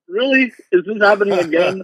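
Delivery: Really? jumps way up in pitch; astonished disbelief. Retold or imitated. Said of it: "And I was like".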